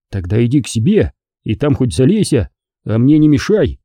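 ordinary delivery

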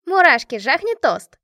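loud, high rowdy demand; bellowing. A narrator's reading. Машка stands up demanding a toast, bellowing. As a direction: shout it across the table. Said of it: "заорала Машка, вставая".

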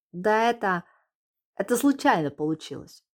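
blurted out. Acted. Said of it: "выпалил Ромка".

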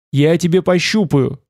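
ordinary delivery

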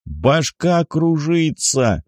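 high, very slow, drawn-out call; deafened man calling out, without a full shout; loud dazed complaint. A narrator's reading. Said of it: "громко произнёс он".

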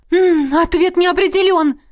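high, bright-loud glad exclaim; pleased the trick works; happy outburst over a test result; delighted. Acted. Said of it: "обрадовалась она".

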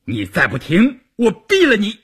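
threat climbing to a high, loud finish; threatening roar. A narrator's reading. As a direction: roar a furious ultimatum at the driver. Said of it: "关山林吼道". (怒吼威胁)

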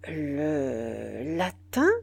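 very slow, low, drawn-out echo rising at the end; stupefied. A narrator's reading. Soldier echoing his general's odd question, stupefied. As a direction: repeat it in baffled disbelief, stretching each word. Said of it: "répéta le noir stupéfait".

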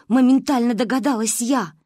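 usual speaking voice

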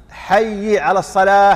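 حي said with the ya under kasra, slow; wrong kasra. Shown as a demonstration. Teacher demonstrating the kasra mistake. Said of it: "حي بكسر إيش؟ الياء".

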